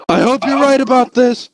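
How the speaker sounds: high, tense worried voice; doubtful and scared; nervous soldier. Retold or imitated. Passenger doubting the driver's plan, nervous. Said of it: "he goes".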